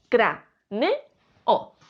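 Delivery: "cra, ne, o" with a short pause after each part; syllable by syllable. Shown as a demonstration. Teacher repeating the split for the class, syllable by syllable. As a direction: space out "cra", "ne", "o" evenly.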